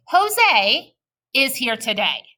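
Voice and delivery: Jose is here today with a small pause after Jose, slow and smooth; scooped phrasing. Shown as a demonstration. Teacher showing kids fluent phrasing, slow scooped reading. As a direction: read in smooth scoops, slowly. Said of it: "watch how I'm gonna read it".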